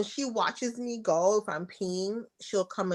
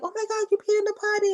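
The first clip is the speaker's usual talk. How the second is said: much higher, fast, gushing praise; sing-song delighted mom; excited praise; 14 semitones higher, much faster than usual. Retold or imitated. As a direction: rush out the praise to a toddler. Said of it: "trying to, you know, create excitement".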